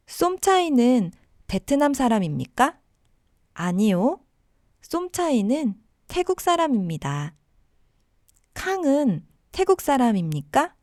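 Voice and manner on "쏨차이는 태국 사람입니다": ordinary talk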